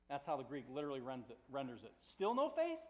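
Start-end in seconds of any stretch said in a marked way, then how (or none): 2.0-2.8 higher-pitched reproachful question; mild stern rebuke. Acted. Jesus to disciples who panicked in the storm, mildly stern. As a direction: chide them with a rising question. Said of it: "but mild rebuke".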